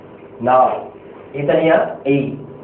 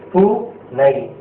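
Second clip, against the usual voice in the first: syllable by syllable; much slower than usual. Shown as a demonstration. Teacher sounding out the word for students, slow and split. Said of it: "இந்த பூனை அப்படின்ற வார்த்தையை எடுத்துங்க".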